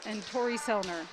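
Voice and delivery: ordinary talk, nothing marked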